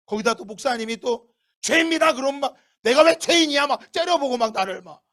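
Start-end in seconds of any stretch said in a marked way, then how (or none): 1.6-2.1 a firm preacher declaring "sin"; pastor's verdict. Retold or imitated. Pastor telling the tired churchgoer, stern pronouncement. 2.8-3.7 louder, higher, offended protest; indignant retort. Retold or imitated. Sleepy churchgoer answering the pastor, indignant retort.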